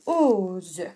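"Ose" as a long, falling ooo-ze; stretched-out dictation. Shown as a demonstration. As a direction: sound out "Ose" slowly, low at the end. Teacher repeats the dictated word. (« Ose » répété lentement : oooo-ze)

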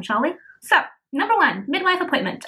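ordinary talk

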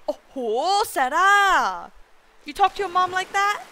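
high, drawn-out imitation of an outraged mom; mock scolding. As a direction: scold like a scandalized mom, hammed up. Acting out the scandalized mom.

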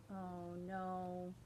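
plain reading voice

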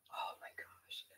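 unvoiced breathy whisper under the breath; exasperated whisper. Real, not acted. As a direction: mutter it so the lady can't hear.

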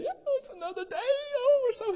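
high, squealing mock-cheerful morning person; hammed-up delighted wake-up voice; perky morning person. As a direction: squeal it out like a chipper early riser. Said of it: "y'all come up out of bed".

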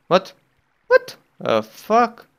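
high startled yelp; surprised. Real, not acted. Player startled by invisible water, startled yelp. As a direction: jump at the glitch. Glitch again.

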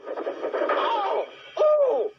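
van crying out in a high voice; high cries. Acted. Van cries out in trouble, high rising yelps. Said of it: "cried the van".

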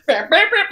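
high loud mock trumpet blasts; imitated mariachi trumpet. She mimics the mariachi band next to her on the subway, loud high trumpet blasts. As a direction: blare it like a mariachi horn. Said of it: "and I hear, like".

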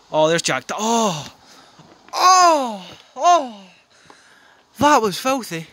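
loud, high delighted cries of oh; ecstatic goal shouts. Real, not acted. Fan erupting as Jack scores a stunning goal. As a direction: erupt with joy at the strike.

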